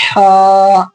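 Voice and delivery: हा with a strong breathy throat "h" and long vowel; drawn-out throaty हा. Shown as a demonstration. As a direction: push हा out from the throat. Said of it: "यहाँ हलक से निकलता है".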